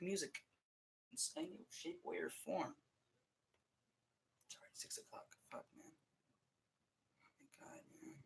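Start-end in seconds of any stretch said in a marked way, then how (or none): 4.5-8.1 muttered aside. Real, not acted. Muttering to himself, late friend.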